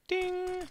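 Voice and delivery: high sung ding like a lift bell; ping it like an elevator chime. Pretend. Elevator bell imitated by caster.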